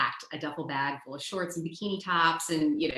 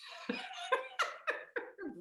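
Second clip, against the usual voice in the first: a high, breathy burst of laughter; 7 dB quieter than usual. Real, not acted. Laughing at her own dusty bus ride, high laugh. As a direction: laugh out at the memory.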